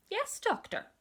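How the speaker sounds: high mock yes doctor; teasing window cleaner. Window cleaner teasing the little girl, high and playful. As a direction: tease her playfully.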